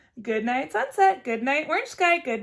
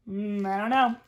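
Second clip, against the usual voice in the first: usual speaking voice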